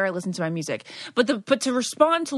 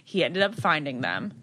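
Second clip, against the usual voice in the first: ordinary delivery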